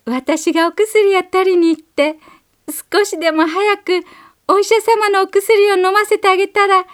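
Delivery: put-on a little girl steeling herself, high and earnest; small girl's brave inner voice. A narrator's reading. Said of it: "心の中で言いました".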